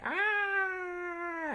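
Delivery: high sustained comic scream; mock scream. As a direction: imitate a drawn-out shriek at moderate volume. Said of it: "as she's screaming".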